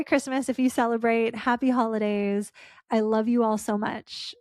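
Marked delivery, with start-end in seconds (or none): none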